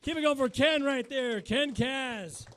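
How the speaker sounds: loud, high emcee call; big loud host shout; hyping the crowd. Real, not acted. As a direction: pump up the crowd for the last act.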